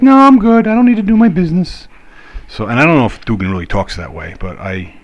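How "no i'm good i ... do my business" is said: high, loud cartoon dog voice; squeaky pet voice, quick and stubborn. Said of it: "use that dog voice".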